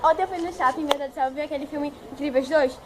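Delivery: normal talking voice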